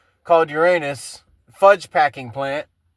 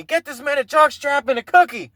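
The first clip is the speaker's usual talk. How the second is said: mild mock yell, pitched up; 8 semitones higher than usual. Retelling a TV line to listeners, mild mock yell. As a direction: toss it out like a rowdy TV shout. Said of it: "somebody yelled at me".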